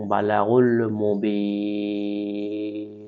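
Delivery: বালাগুল with গ from the back of the throat, slow and chanted; throat গ sound. Shown as a demonstration. Teacher showing the গইন from the throat's end. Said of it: "এ গইনটা উচ্চারণ করতে হবে".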